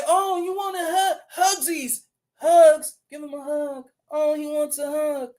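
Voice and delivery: high, mock-cooing woman voice; mocking cooing women. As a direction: coo it like a fussing woman, teasing. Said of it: "women might say".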